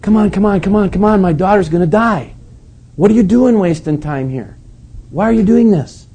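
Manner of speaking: high, urgent pleading to hurry; a desperate dad pushing Jesus along; father begging Jesus to hurry up, impatient and high; impatient father Jairus. Retold or imitated. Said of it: "Jairus is probably going".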